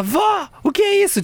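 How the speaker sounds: surprised question. Retold or imitated. Asking grandma about old food.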